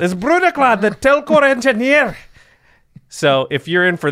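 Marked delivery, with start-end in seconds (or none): 0.0-2.2 high, loud hammed-up Scottish accent. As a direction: announce it with a big Scottish brogue. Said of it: "if you say it, like, Scottish".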